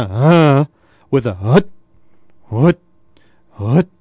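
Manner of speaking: short grunted huts from the stomach; belly grunts. Shown as a demonstration. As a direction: chop each hut off abruptly. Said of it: "you wanna grunt from your stomach".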